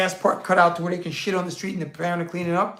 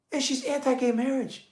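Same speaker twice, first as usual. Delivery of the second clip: usual speaking voice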